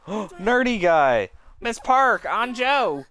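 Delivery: slow, loud startled cry; startled call. Acted. Student is startled by the hidden classmate, surprised. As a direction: gasp out the names in surprise.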